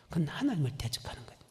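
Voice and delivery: ordinary talk, nothing marked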